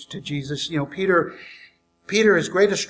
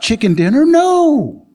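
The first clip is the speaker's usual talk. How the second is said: usual speaking voice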